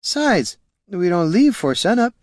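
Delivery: high-pitched tipsy argument; drunken excuse. A narrator's reading. Drunk ranger arguing about departure time, tipsy. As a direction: argue back like a drunk.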